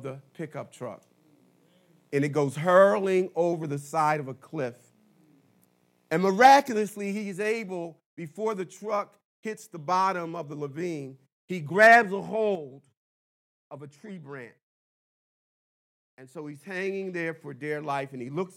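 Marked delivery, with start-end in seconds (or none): none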